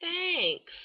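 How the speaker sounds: high, put-on little thanks; imitated awkward thanks. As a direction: mimic an awkwardly polite doctor. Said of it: "lady was just like".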